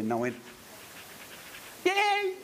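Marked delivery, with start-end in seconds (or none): 1.8-2.3 high-pitched squeal of glee; thrilled little "yay"; delighted cheer. Retold or imitated. Retold delighted reply to a request.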